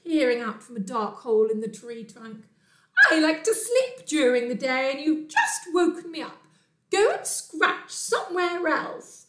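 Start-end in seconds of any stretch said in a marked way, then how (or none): 3.1-9.3 high, indignant telling-off; cross tawny owl. Acted. Owl woken in the day scolds Bear, shrill indignation. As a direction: scold in a raised, emphatic owl voice. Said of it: "shrieked a tawny owl".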